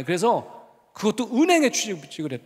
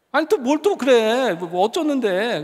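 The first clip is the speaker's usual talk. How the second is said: higher, louder, drawn-out retold protest; re-enacted fed-up teacher, pitched up; exasperated retort; 3 semitones higher, 4 dB louder, slower than usual. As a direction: stretch it out in mock annoyance. Retorts to student's repeated apology.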